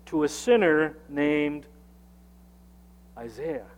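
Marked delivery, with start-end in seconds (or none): none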